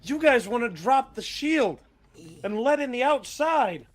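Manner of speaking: high, loud protesting rioter; heckler piping up. Acted. Rioter challenging the speaker over the shield, high indignant heckle. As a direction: shout back challengingly, pitched well up. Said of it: "One of them pipes up".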